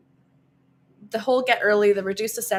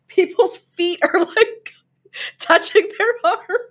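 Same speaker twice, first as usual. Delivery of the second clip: grossed-out laughing; 9 semitones higher, 6 dB louder, slower than usual. Real, not acted.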